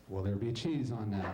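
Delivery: low, flat drive-thru drone; bored drive-thru worker. Acted. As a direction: drone it like a tired order-taker.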